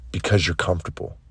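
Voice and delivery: low, soft, quick drop; quiet verdict. Acted. Coach telling listeners why they lack answers, quiet verdict. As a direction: let the answer fall away, low and soft. Refrain dropped after each challenge.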